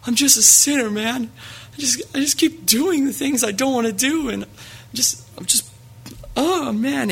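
high-pitched mock-tearful whine of a kid; imitated crying. Crying junior high kid confessing to his pastor, imitated tearful voice. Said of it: "And he's just crying".

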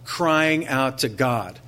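slow, with a heavy push on crying; slow and emphatic. Real, not acted. Preacher explains how he reads the verse, slow and emphatic. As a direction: draw out crying out to God, emphatic. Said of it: "You know how I read that?".

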